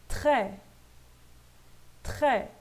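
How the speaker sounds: "Très" ending on open è, no S; silent S. Shown as a demonstration. Teacher modelling the word twice, silent S. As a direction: say "Très" crisply, dropping the final S. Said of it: "Não leio o S".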